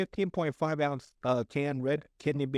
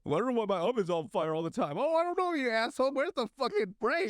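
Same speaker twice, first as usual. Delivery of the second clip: high-pitched, hammed-up indignation; mock exasperated rant; 8 semitones higher than usual. Acting out cousin's oven fire, hammed-up high outrage. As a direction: play a comic squabble, pitch climbing high.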